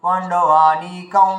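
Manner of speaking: পাণ্ডবানিকং with the va pulled up into the nose and held; slowly nasalise the va of পাণ্ডবানিকং; teacher showing the nasal va; nasalised va. Shown as a demonstration. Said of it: "নাকে তুলে টেনে নিয়ে যেতে হবে".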